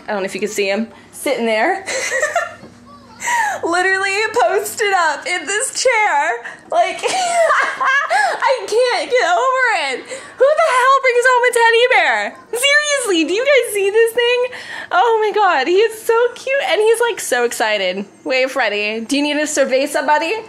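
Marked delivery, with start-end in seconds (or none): 1.9-14.4 high giggly voice, laughing through the words. Real, not acted. Giggling over teddy bear on chair. 14.9-17.2 cute gushing. Real, not acted. Cooing at the teddy bear.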